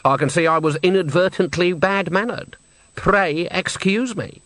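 Granny apologising with frosty exaggerated courtesy; icy politeness. A narrator's reading.

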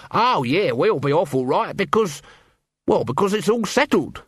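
lightly voiced Nobby playing along. A narrator's reading. Nobby backing up Colon's hint to leave, lightly voiced.

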